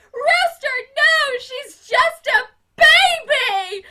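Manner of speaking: high, loud, drawn-out pleading shriek; desperate scream. Retold or imitated. Miss Hannigan begging Rooster to spare the girl, slow desperate shriek. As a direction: stretch the last words out in a wail. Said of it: "Miss Hannigan's running after him like".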